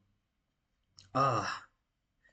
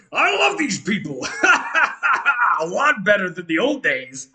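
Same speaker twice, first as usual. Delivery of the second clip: gleeful and laughing, louder; laughing delight; 6 semitones higher, 7 dB louder than usual. Acted. Fey figure enjoying the magic tricks, laughing. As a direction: giggle through the line, delighted. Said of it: "he goes".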